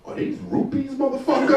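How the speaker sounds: high, sharp mocking retort; homeless man's retort. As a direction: fire back high and insulted. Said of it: "he was like".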